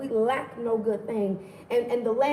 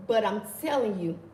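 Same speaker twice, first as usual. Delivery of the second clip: ordinary talk, nothing marked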